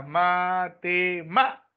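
"matemática" slowly split into ma-te-MÁ-tica; syllable by syllable. Shown as a demonstration. Teacher finding the stressed syllable for students, slow syllable-by-syllable "matemática". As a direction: sound out "matemática" in separate syllables, leaning on "má". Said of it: "tengo que saber qué clase de palabra es".

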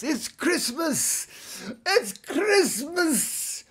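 very high, drawn-out holiday holler; mock Christmas yell. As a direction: stretch it out in a hammed-up, overexcited cry. Hammed-up Christmas yelling bit.

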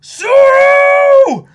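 high, loud shout of the name; excited shout. Real, not acted. Watching with a friend, hyped high shout for Zoro. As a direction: cheer as he jumps in.

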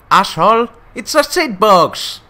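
put-on high, loud outburst in an accent; imitated film rant, last words stretched out; Daniel Brühl as Niki Lauda. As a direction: yell it high like an accented movie character. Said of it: "El acento lo pone calcao".

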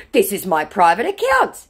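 mock an insistent scammer; scammer insisting. Scammer reiterating his claim to a doubter, high mock insistence. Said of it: "he reiterates".

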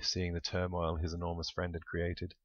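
ordinary delivery, nothing marked

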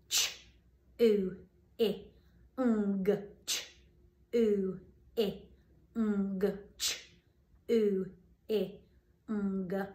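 sounding out ch, oo, I, ng one sound at a time; segmenting into sounds. Shown as a demonstration. Teacher sounds out a word for children, one sound at a time. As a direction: separate each phoneme with a pause. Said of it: "Let's try this first one".

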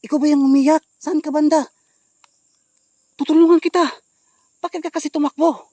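loud, high-pitched calls; calling out. Real, not acted. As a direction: call out to someone hiding out of sight. Calling to hidden crying woman. (malakas na pagtawag sa nagtatago)

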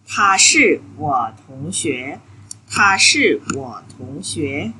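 slow, careful Mandarin model sentence 他是我同学; teacher sounds out 他是我同学 slowly in Mandarin; slow model Chinese. Shown as a demonstration. Teacher modeling for students, slow clear Mandarin. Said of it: "Các bạn chú ý cái, cái cách nói này nha".

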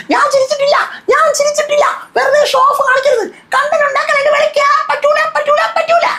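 shrill-pitched parody of the angry housemate; mocking Rena. Vlogger mocking Rena demanding when she laughed, squeaky high parody.

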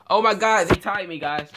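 high, loud alarmed cry; surprised. Real, not acted. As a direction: yelp it in sudden alarm. Caught by the character.

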